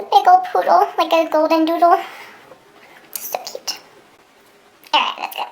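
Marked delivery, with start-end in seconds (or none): 3.1-3.8 whisper. Real, not acted. Whispering about dog.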